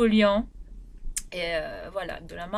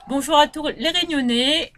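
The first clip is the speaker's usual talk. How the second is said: salut enjoué; 6 semitones higher, 8 dB louder than usual. Real, not acted. Salue la Réunion avec entrain.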